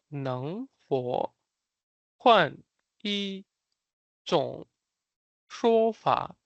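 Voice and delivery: 能否, 换, 一, 种, 说法 each said apart, very slowly; word by word. Shown as a demonstration. Teacher showing learners how to say the phrase, word by word. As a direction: draw out 能否换一种说法 with a pause between words.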